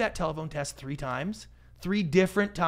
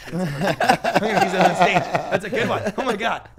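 real laughter bursting through loud, higher words; laughing; 4 semitones higher, 9 dB louder than usual. Real, not acted. Guest delighted by his own joke, laughing as he talks. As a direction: talk helplessly through the laugh. Cracks up at own lunch line.

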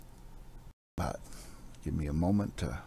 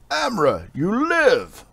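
much higher, louder cry of relief; joyful cry; 14 semitones higher, 10 dB louder, slower than usual. Acted. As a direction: shout with joy at finding a friend alive. Warriors find Amra alive.

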